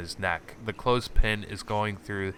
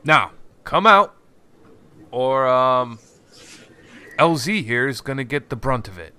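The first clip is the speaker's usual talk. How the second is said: higher and louder, calling into the dark; calling out threat; 5 semitones higher, 8 dB louder than usual. Acted. Boss calling to the hidden stranger, loud threat. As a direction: shout the ultimatum outward. NPC calls out hidden player.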